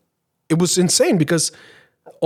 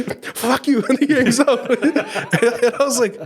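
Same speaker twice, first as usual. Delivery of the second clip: high mock curse breaking into laughter; laughing retold curse; 7 semitones higher, much slower than usual. Retelling the scammer hanging up a second time, laughing. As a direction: squeak out the insult, then crack up.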